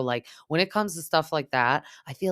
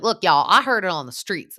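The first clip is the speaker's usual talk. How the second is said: loud, higher mock Southern accent; Sutton; 5 semitones higher, 8 dB louder than usual. Imitating Sutton defending herself on the spot, deepened Southern accent. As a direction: lay the Southern accent on thick. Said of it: "just getting deeper and deeper".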